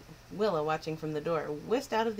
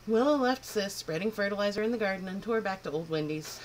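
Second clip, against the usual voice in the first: usual speaking voice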